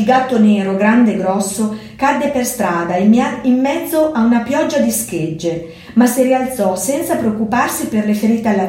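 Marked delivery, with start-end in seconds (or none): none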